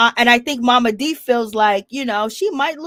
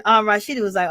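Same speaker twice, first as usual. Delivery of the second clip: usual speaking voice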